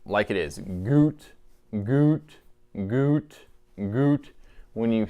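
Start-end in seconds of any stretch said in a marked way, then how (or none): 0.6-4.3 short voiced "goot" sounds, vocal cords buzzing; voiced goot syllables. Shown as a demonstration. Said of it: "I like to say the word goot into the call".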